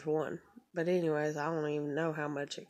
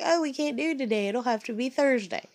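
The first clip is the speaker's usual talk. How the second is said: much higher sing-song brush-off; mock dispatcher; 6 semitones higher, 4 dB louder than usual. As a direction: pitch it way up, falsely cheerful.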